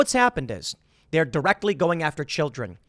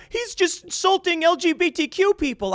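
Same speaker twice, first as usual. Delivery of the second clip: high, put-on voice mocking the objection; mocking whiny protest; 12 semitones higher than usual. Speaker mocking the LGBTQ crowd's complaint, high mock voice. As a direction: parody them with a high mocking whine.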